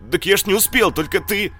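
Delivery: high, loud hunted babbling; cornered and terrified; frightened pleading. Acted. Мародёр затравленно оправдывается. (затравленное оправдание)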